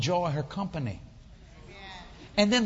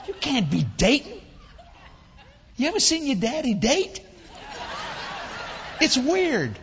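higher, louder incredulous protest; retold disbelief; 6 semitones higher, 3 dB louder than usual. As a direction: protest like a scandalised grown child. Retold disbelief at father's dating.

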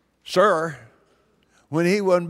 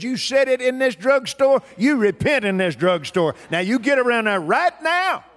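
loud, emphatic rebuke; a stern, no-excuses command; stern insistence; 7 semitones higher, 5 dB louder than usual. Retold or imitated. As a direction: lay down the law, punching the words. The Lord sternly corrects him in story.

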